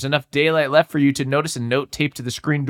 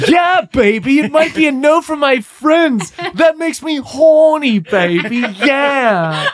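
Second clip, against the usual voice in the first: high, loud groovy British-spy voice; hammed-up Austin Powers British accent, pitched high and loud; Austin Powers impression; 9 semitones higher, 3 dB louder, slower than usual. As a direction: swing it like Austin Powers, shouting it out. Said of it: "an Austin Powers thing".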